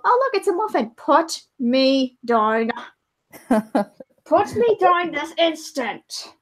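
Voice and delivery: high, slow indignant voice; slow demanding muffin. Pretend. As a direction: draw out each word as an indignant little muffin. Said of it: "He probably just go".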